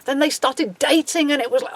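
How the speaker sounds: ordinary talk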